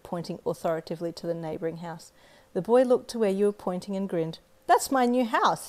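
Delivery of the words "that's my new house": put-on higher, louder, a touch slower excited little boy. Little boy shows off his new house, excited high voice. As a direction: beam it out proudly. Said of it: "he said excitedly".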